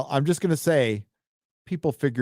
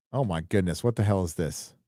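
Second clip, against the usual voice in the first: ordinary talk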